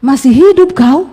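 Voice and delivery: loud, drawn-out astonished question; blunt disbelief. Retold or imitated. Preacher meets former riot instigator, acted loud disbelief. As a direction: re-enact incredulity, leaning on the words. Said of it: "terucap dari mulut saya begini".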